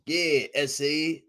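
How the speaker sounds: put-on slow lightly done Mexican accent. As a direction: lay the Mexican slang on slowly. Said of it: "No, that's Mexican".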